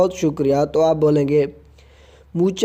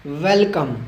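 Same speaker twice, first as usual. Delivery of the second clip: ordinary talk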